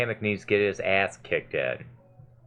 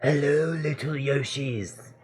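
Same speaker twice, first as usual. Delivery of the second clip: slow, higher sly villain greeting; Kamek voice; 4 semitones higher, slower than usual. Acted. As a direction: drawl it out like a scheming wizard. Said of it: "I gotta think of a voice for Kamek".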